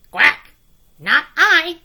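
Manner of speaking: very loud quacking refusal; a duck shouting no. A narrator's reading. Duck refusing the hen, very loud. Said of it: "said the duck".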